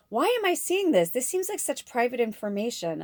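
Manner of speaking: lightly acted incredulous questioning; mild retold dismay. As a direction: replay your own disbelief, pitch jumping up at the start. Retelling her shocked reaction to app.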